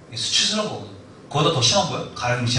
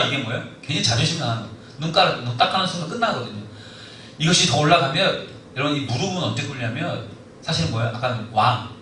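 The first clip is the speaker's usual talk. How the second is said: ordinary delivery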